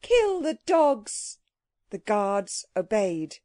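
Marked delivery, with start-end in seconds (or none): 0.0-1.4 high, drawn-out imperious command; a slow, high regal cry; queen crying out. A narrator's reading. The Queen sets guards on the crowd, high drawn-out command. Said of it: "cried the Queen".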